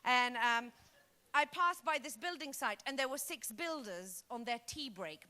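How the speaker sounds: ordinary talk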